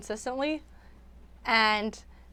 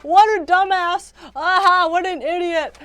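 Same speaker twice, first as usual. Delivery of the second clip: mocking Terrence; 7 semitones higher, 7 dB louder than usual. Vlogger imitating Terrence mocking a video, loud high scoff. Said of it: "he'll just laugh and be like".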